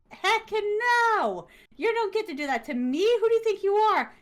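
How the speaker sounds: high, loud sassy telling-off; mock outrage. Kicking the muse out, with high, loud mock outrage. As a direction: play it up as indignant.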